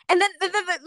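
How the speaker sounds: excited stutter. Retold or imitated. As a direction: stammer excitedly over the same word. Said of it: "I stutter".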